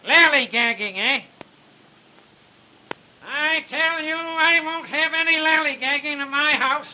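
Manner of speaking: high, loud scolding; shrill angry old man. Acted. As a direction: scold him, slow and high.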